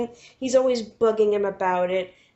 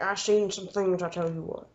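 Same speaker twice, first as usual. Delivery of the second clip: mock old man's voice, trailing softer; old guy; 4 semitones lower, 5 dB quieter than usual. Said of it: "the old guy who was like".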